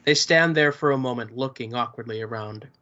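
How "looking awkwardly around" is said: ordinary delivery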